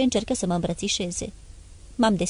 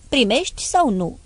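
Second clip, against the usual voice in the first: sharp, cutting question, a bit raised; curt demand; 4 semitones higher, 4 dB louder than usual. A narrator's reading. Father pressing his son for an answer, cutting. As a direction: snap the ultimatum at him. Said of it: "am adăugat tăios".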